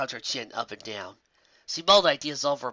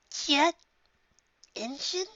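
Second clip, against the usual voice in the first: put-on high, puzzled young unicorn; young Twilight voice; much slower than usual. A narrator's reading. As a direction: read it with curious surprise. Twilight reads the diagram.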